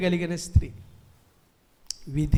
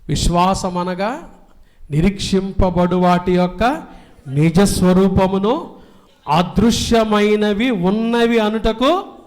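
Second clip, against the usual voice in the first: raised, ringing pulpit voice; fiery preaching; 2 semitones higher, 6 dB louder than usual. Acted. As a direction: preach it out to the whole church. Quoting scripture forcefully. (ఉత్సాహంగా బోధించే స్వరం)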